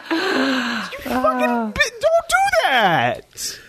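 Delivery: high laughing yell, slow; laughing shout. Real, not acted. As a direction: shriek it through laughter. Mock outrage at sabotage joke.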